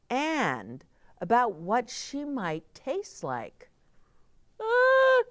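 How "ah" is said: high, loud shriek of fright; startled scream. Acted. Old woman frightened by the curious bears, a high shriek. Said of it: "the old woman shouted".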